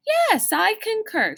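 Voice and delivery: a brisk caretaker reporting; matter-of-fact. A narrator's reading. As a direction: state it as plain fact. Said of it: "she said matter-of-factly".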